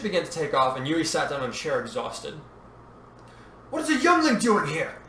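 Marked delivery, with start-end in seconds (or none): 3.7-5.0 high-pitched indignant outburst; older man's high, indignant cry; outraged shout. A narrator's reading. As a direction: exclaim it in outraged disbelief. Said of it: "the older man shouted".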